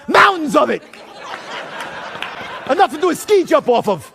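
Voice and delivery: acted-out furious retort; livid. Livid applicant answering the cocaine question. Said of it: "I'm livid".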